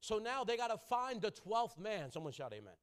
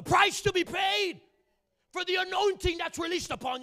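higher, louder, slowed preaching cry; preaching at full force; 10 semitones higher, 11 dB louder than usual. Acted. Preacher calling out to the congregation, loud and high. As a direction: drive the price home to the pews.

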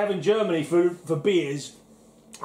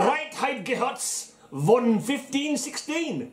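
put-on slow, high, hammy German accent; 8 semitones higher, much slower than usual. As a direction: read it like a proud Bavarian. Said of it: "Give me a chance to get my German accent out".

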